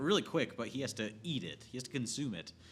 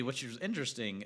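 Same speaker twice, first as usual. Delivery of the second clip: normal talking voice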